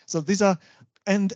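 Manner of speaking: ordinary talk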